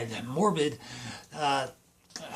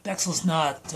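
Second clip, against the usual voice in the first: usual speaking voice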